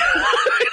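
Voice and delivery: high breathy burst of laughter; big laugh. Real, not acted. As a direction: crack up at yourself. Laughs after his yell.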